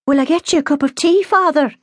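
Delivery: high, fast, nervous mother. A narrator's reading. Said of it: "voice shaking with nervousness".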